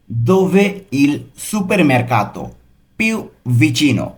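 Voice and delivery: slow, careful Italian for learners; very slow model reading. Shown as a demonstration. Teacher models the phrase slowly for students. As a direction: spell it out slowly for the class. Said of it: "এটার Italian কী হবে?".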